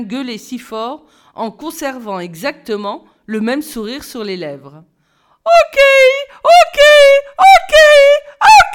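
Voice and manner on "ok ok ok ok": rising, very high and very loud bellow; swelling siren yell. A narrator's reading. Simon bellowing over the class, rising to a siren shout. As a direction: swell like a siren, still grinning. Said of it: "enfler comme une sirène".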